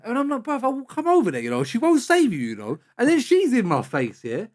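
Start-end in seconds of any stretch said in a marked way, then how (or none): 0.4-2.8 high-pitched, heated warning; acting out a worked-up warning; retold threat. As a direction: threaten him as if back in the row. Retold threat during street confrontation.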